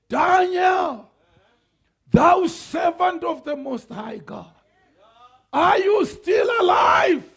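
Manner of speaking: high, loud anxious call into the den; king calling out. Acted. King calls into lion's den.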